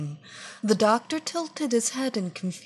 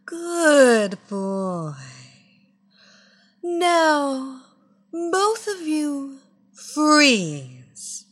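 louder, slow hypnotic command; commanding hypnotist; 4 semitones higher, 5 dB louder, much slower than usual. A narrator's reading. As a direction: freeze them with one command. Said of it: "Evelina commanded".

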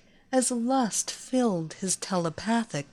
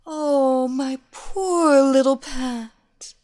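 louder, higher mocking coo; taunting; 4 semitones higher, 8 dB louder, slower than usual. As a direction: tease him from above. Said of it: "Evelina taunted".

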